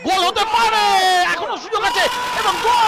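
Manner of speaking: high, loud goal call. Real, not acted. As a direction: build up and shout the goal. Corner leads to a goal.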